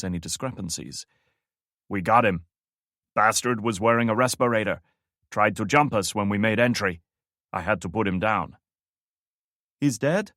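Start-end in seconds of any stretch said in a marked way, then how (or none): 1.9-8.6 louder, borrowed guard's voice; imitating Lopez. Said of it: "imitating the man's accent and tonal inflection". 9.8-10.3 relieved radio voice. Acted.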